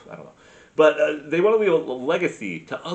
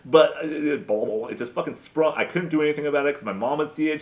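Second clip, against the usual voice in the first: usual speaking voice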